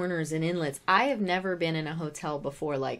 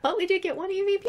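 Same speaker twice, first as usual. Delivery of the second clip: higher, louder and fast, bouncing back; excited; 13 semitones higher, 5 dB louder, faster than usual. Acted. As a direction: perk right up, upbeat and quick. Cheerful comeback after the joke.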